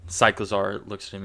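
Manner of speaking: normal talking voice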